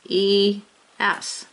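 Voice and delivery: "suffix E S" stretched out slowly; slow spelling. Shown as a demonstration. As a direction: drag out the suffix letters. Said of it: "Let's begin".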